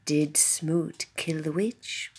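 hushed storyteller whisper. Acted.

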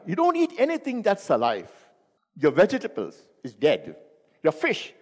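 usual speaking voice

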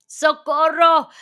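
high, loud, drawn-out cry for help; terrified scream. A narrator's reading. Trapped boy screaming towards the doors for help, in terror. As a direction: scream for rescue. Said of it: "grité".

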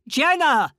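high, loud desperate yell of a name; shout at full voice. Acted. Woman yells her friend's name to scare the attacker off, full shout. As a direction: scream the name to summon help. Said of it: "at the top of my voice".

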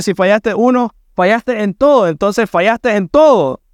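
climbing pulpit intensity; fervent preaching. Acted. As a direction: press the sins on the congregation with rising fervor. Preacher rising to full force.

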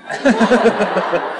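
sudden high laugh; burst of laughter. Real, not acted. Laughing at his own nerves.

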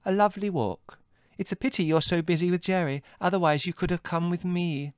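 put-on high, light breezy girl's voice; airily. A narrator's reading. Margot luring Adrian into a walk, airy. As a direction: toss it off casually as bait. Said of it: "said Margot airily".

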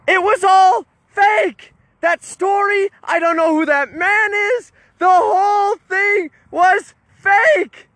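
high, emphatic retold outburst; slow, dramatic mock shout; shouted confession. Retold confession to stunned viewers.

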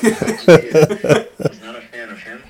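laughing through the words; chuckling while saying it. Real, not acted. Laughing while admitting dislike.

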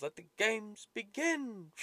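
slow, high grand announcer. Retold or imitated. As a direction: boom it out like the movie announcer. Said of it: "the guy does the announcement and then goes".

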